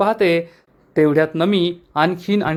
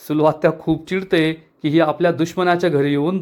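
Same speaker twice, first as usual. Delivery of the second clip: ordinary delivery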